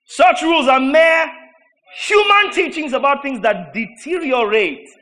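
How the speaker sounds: slow, loud, high proclamation; preaching shout. Real, not acted. Preacher reading the verse to the church, slow loud shout. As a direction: drive each word home, shouting. Preacher thundering scripture at congregation.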